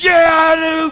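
high, loud shouted answer; retold yell. Biker yelling back at the preacher, high and loud. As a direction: snap it back in pain. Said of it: "he said".

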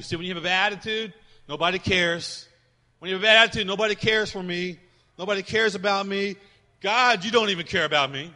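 loud, punchy preaching run. Acted. Pastor mimicking self-pity, loud preaching. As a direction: drive home the complaint.

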